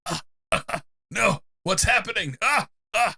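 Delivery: high, rising panicked gasps; panicked gasping. Acted. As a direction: gasp in choking panic. Vampire choking in pain.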